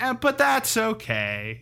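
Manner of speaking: high, drawn-out comedy punchline; imitated punchline. Host quoting the Cosby bit's punchline, high drawn-out delivery. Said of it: "the Cosby bit's like".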